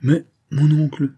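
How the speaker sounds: protestation plus haute, un peu théâtrale; récrie-toi, le ton qui monte; exclamation de protestation. A narrator's reading. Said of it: "s'écria le baron de Pascroix". (il s'écrie, protestant)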